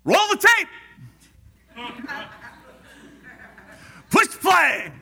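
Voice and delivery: high loud shouted command; Jesus calling out. Retold or imitated. Jesus calling up a life replay, loud high shout. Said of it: "Jesus is gonna say".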